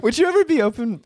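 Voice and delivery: put-on high-pitched girlfriend asking carefully; acted girlfriend. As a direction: repeat the delicate ask in a high voice. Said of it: "And she goes".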